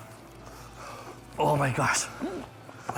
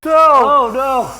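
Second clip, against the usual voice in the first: pained cry; 11 semitones higher, 13 dB louder, slower than usual. Real, not acted. Pain from hot sauce.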